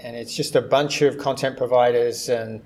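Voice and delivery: ordinary talk, nothing marked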